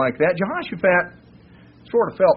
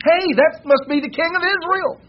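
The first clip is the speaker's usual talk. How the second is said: high, loud call across the battlefield; 14 semitones higher, 4 dB louder than usual. Retold or imitated. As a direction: shout it as a soldier spotting his target. Enemy captains spot the king.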